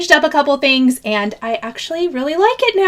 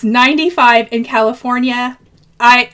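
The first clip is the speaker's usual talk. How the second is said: usual speaking voice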